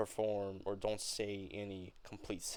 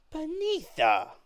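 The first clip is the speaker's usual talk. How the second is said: shocked cry; 8 dB louder than usual. Acted. Mama shocked at blasphemy.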